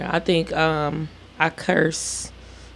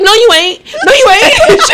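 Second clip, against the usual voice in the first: high, rising contradiction; exasperated mom, pitched up; retold angry refusal; 11 semitones higher, 5 dB louder than usual. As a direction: re-enact shooting down an apology, second time higher and louder. Mother rejecting child's apology, retold.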